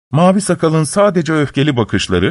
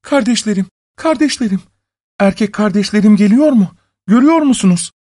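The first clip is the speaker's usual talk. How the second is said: high, urgent woman's call; 9 semitones higher, faster than usual. A narrator's reading. Wife asking her sisters on the walls, high urgent call. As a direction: call anxiously up to someone, pitched high. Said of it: "kız kardeşlerine seslenmiş".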